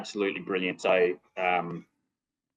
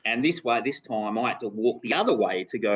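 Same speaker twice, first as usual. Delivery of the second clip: normal talking voice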